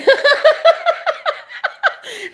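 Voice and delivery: long, loud, high cackle; high laughter. Real, not acted. Laughing that everybody talks about me, high cackle. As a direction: cackle at your own joke.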